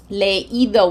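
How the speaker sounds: leído said correctly as le-Í-do; leído in three syllables, emphasis on Í; accented, separate I. Shown as a demonstration. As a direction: break E from I in leído, stressing the I. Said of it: "we put an accent".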